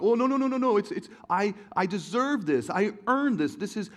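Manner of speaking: put-on high, loud whiny child protest; whiny kid. Kid whining at dad over screen time, high and loud. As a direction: whine like a kid losing his screen.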